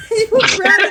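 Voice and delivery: giggling you grounded; laughing tease. Real, not acted. Teasing friend, laughing.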